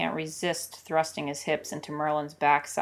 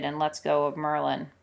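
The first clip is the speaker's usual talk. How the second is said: ordinary delivery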